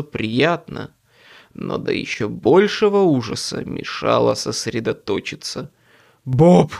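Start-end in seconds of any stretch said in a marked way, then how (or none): 6.2-6.7 loud angry outburst; exploding in anger. A narrator's reading. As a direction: blow up at him. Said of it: "взорвался я".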